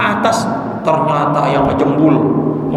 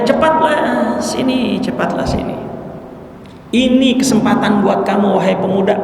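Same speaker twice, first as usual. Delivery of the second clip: urgent call; 6 semitones higher than usual. Retold or imitated. Finder at the well calling to the boy, urgent. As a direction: call the young man up from the well. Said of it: "Apa katanya?".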